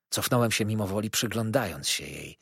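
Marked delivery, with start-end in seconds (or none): none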